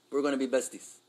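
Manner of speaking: normal talking voice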